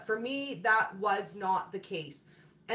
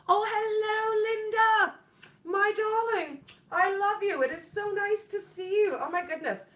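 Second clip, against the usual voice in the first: stretched, high gushing welcome to a viewer; gushing greeting; 10 semitones higher than usual. Real, not acted. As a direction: coo over a dear friend arriving. Greets viewer Linda in chat.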